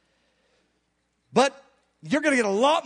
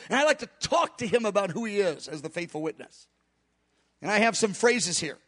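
ordinary talk, nothing marked

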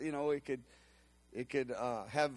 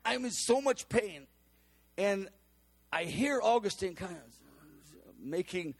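usual speaking voice

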